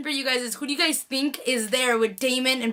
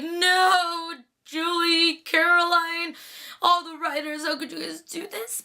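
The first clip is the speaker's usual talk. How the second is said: high, drawn-out fan dismay; mock anguished wail; 4 semitones higher, slower than usual. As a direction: plead with the writers in hammed-up despair. Fan wailing at the show's writers.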